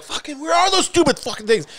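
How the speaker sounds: high-pitched frantic grumble; hammed-up annoyed muttering; frustrated search. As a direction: rant while hunting for glasses. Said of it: "looking around the house like".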